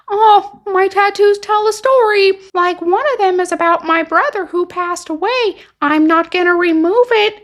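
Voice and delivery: high, whiny protest; mock girlfriend voice. Girlfriend refusing dad's tattoo demand, mock high protest.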